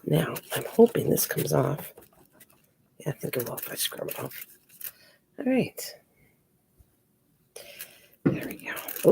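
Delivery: soft unvoiced whisper while working; hushed murmur to yourself. Real, not acted. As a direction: whisper it to yourself over the crafts. Whispering while crafting on stream.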